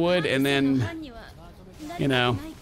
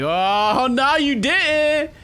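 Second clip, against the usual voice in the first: slow, drawn-out mock affront, higher; sassy Titan retort; 8 semitones higher, 4 dB louder, much slower than usual. Titan coming after the player, mock affronted. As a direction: voice a Titan's exaggerated offence. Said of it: "He's like".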